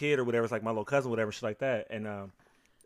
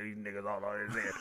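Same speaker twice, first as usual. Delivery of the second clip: grandpa impression; 3 semitones lower, 12 dB quieter than usual. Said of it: "kept killing her with the impressions".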